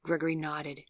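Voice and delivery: usual speaking voice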